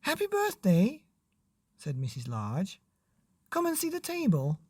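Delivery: high, quick bright mother's voice; high mum greeting dad on his birthday. Acted. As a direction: voice the cheerful mum.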